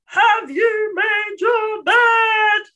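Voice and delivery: high, drawn-out yell. Acted. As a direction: yell it like a fed-up parent calling to a child. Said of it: "instead of yelling".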